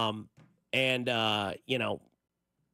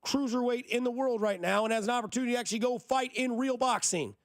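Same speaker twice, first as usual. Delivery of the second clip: higher, heated, insistent rant; mad rant; 8 semitones higher than usual. Real, not acted. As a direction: vent real frustration at the critics. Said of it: "I'm just mad".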